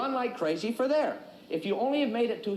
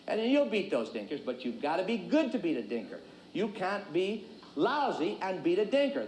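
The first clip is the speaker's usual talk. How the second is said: ordinary delivery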